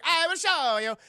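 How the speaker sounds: high, loud hammy dictator boast; hammed-up Mussolini. Mussolini answering his guests, hammed-up and loud. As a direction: boom it like a cartoon dictator. Mussolini voiced in retold scene.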